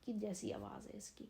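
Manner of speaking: ordinary talk, nothing marked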